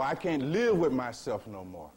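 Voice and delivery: loud, exasperated cry of a fed-up self; despairing outburst. Retold or imitated. Teacher voicing a person sick of himself, loud despairing outburst. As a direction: blurt it out as someone at the end of their rope.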